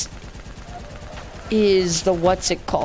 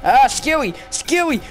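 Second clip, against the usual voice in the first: frustrated outcry; 9 semitones higher, 4 dB louder than usual. Real, not acted. Cries out at game failure.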